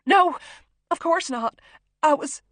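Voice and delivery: high, loud, panicked protest; frightened, breaking-off denial; desperate denial. A narrator's reading. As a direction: hurry to placate him in fear. Desperate hostage denies the accusation.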